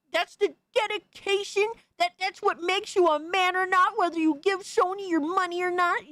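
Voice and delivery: ridiculing impression of the man he is arguing with; mocking whiny impression. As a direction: parrot his argument in a high sneering voice. Mocking the critic's argument back.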